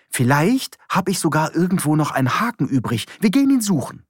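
Johann's voice, urgent. A narrator's reading. Johann begging sleepy Petra into the camper, high urgent voice.

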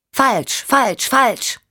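put-on high, emphatic child repeating herself; parrot-like repetition. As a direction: punch each word high, like a parrot. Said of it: "Wie ein Papagei". (hoch und nachdrücklich, wie ein Papagei)

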